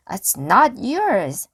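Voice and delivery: loud slow protest; shouted. A narrator's reading. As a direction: protest loudly at the pirate. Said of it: "shouted Annie".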